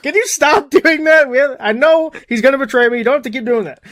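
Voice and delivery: giggling as a fed-up Jesus; exasperated Jesus. Retold or imitated. Jesus voiced, exasperated, laughing.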